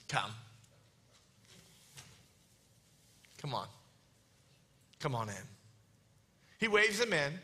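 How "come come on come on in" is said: low, soft invitation with long pauses; understated beckoning. Acted. Jesus waving Peter in, low and understated. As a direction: beckon gently, a word at a time. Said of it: "He had to been like".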